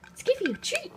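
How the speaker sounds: talking to a dog. Real, not acted. Offering dog a treat.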